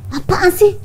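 high, loud "what are you on about"; aunt brushing off the nephew's proposal, startled; startled rebuff. Retold or imitated.

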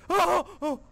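high loud panicked yelps; startled cry. Real, not acted. Player startled by a man in the room, crying out. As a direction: cry out in fright. Figure appears.

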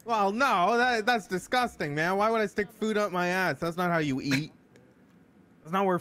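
high, loud offended protest; indignant. Acted. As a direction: act scandalized by the idea.

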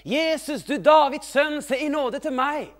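high, loud plea across a crowd; calling out. Acted. Blind man calling to Jesus for mercy, high loud shout. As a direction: cry out loudly for mercy. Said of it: "Så han begynte å rope".

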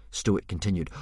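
ordinary delivery, nothing marked

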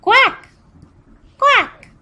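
very high, loud quacking; duck quack. Shown as a demonstration. Teacher showing kids a duck, high loud quacking. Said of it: "A duck says".

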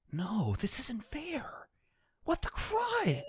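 slow panicked whisper. Acted. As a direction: whisper in dread.

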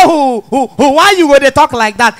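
comic stammerer blurting his way to a word; hooting false starts, then the word breaks free; stammering. Acted. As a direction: act out a chronic stammer. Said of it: "the first stammerer come dey answer am".

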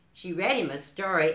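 ordinary delivery, nothing marked

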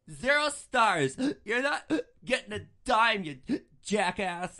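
put-on slow, loud, high drunken yelling; drunk parting shot. Drunk passenger yelling at the driver as she leaves, slow and loud. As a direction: spit out the insults as she stumbles off. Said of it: "the only thing she's saying is".

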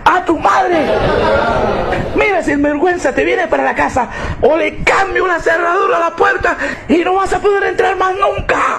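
wife shouting threats at her lying husband; furious shout. Acted. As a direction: scream the ultimatum at him.

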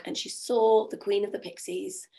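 ordinary delivery

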